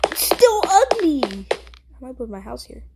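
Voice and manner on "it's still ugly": much higher, louder scornful whine; disgusted. Real, not acted. Kid still judging the ugly cave, high and disgusted. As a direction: really lean into how gross it is.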